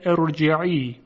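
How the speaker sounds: ارجعی with a thick, full r; heavy r. Shown as a demonstration. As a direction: thicken the r in ارجعی after the opening i.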